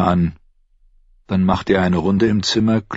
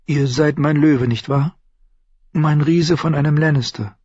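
higher voice of a woman in love; adoring mistress; 8 semitones higher than usual. Acted. Shay to her lion Tyrion, higher adoring voice. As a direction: flatter him as his lover, pitched up.

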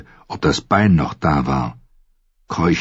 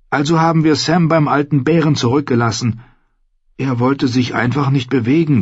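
hohe, drängende Jungenstimme; aufgewühlter Junge; 8 semitones higher than usual. A narrator's reading. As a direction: rechtfertige dich aufgewühlt, in hoher Stimme. Gren rechtfertigt das Zurücklassen aufgewühlt. (aufgewühltes, hohes Berichten)

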